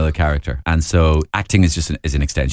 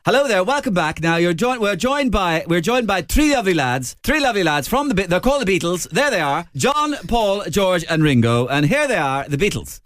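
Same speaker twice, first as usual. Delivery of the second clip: high, bright sixties host voice, well above his own; 11 semitones higher than usual. Retold or imitated. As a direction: play the jolly early TV presenter introducing the band. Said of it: "So is it".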